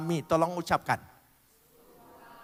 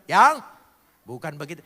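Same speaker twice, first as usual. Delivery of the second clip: harsh snapped yang; 2 semitones lower, 8 dB louder than usual. Shown as a demonstration. Husband snapping at his wife, how not to call her. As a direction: snap "Yang" at your wife like an order.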